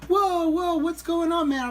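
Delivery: high-pitched startled stranger; imitated alarmed protest. Imitates stranger being patted down.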